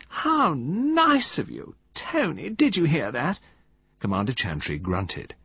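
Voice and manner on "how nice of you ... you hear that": high, slow, delighted coo; cried delightedly. Acted. Valentine thrilled by the compliment, crying out delightedly in a high coo. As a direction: gush with delight. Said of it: "She cried delightedly".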